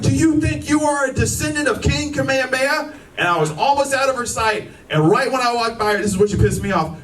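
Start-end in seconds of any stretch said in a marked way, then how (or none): 0.0-2.9 mock heckling homeless woman; imitating a woman screaming at him on the street; homeless woman yelling. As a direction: jeer at him, mock taunting. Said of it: "She was like".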